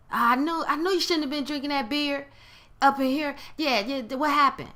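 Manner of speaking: high-pitched, eager I-told-you-so; happy gloating. Acted. Friend sees their thinking being manifest, gloating. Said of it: "they were happy to hear".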